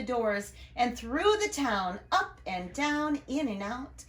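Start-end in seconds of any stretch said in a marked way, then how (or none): none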